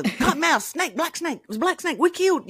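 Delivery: high-pitched imitation of excited kids; yelling little boys. Little boys reporting a killed snake, high excited imitation. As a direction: tumble it out like an excited little boy. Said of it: "you know, little boys".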